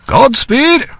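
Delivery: a hint of a call up to the sky. A narrator's reading. Laurence calling a farewell to Edith as she rises. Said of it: "he called".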